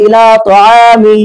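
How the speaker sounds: slow Quranic ইলা তাআমি ending clean on the vowel; pausal form. Shown as a demonstration. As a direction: say ইলা তাআমি slowly and drop the হি at the end. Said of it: "আমি যখন ওয়াকফ করে বলছি".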